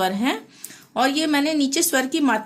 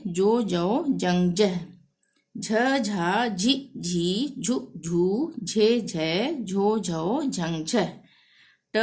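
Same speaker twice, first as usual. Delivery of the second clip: barakhadi recitation; 2 semitones lower, much slower than usual. Acted. Teacher reciting the syllable chart for students to repeat. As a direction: recite each pair evenly like a drill.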